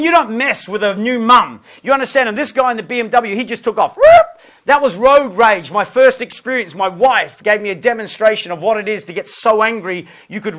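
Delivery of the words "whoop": very high, loud tyre-like whoop; car take-off screech. Retold or imitated. Man mimicking BMW driver fleeing, high loud screech. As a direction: imitate a car screeching away.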